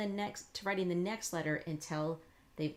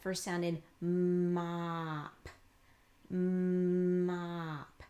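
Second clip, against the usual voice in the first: "Mop." slow and louder, leaning on the m; stress on first sound; 4 dB louder, much slower than usual. Shown as a demonstration. Teacher points the learner to the first sound, slow and emphatic. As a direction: stretch "mop" and punch the opening "m". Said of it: "listen in this very first place".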